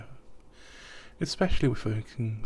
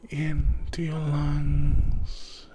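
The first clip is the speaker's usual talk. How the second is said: drawn-out breath cue; 6 semitones higher, 6 dB louder, much slower than usual. Acted.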